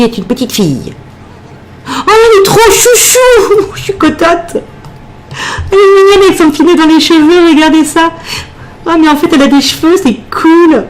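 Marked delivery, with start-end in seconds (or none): 1.9-10.8 voix très aiguë, gaga devant le bébé; attendrissement surexcité. Real, not acted. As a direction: couiner d'adoration devant une toute petite fille. S'extasie devant sa petite fille. (voix suraiguë, complètement attendrie)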